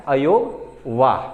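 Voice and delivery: अयोग वाह said slowly, drawn out, stress on अयोग; teacher drilling the word; slow emphatic term. Shown as a demonstration. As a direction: spell out the term slowly for students. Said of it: "अयोग वाह कह दिया गया है".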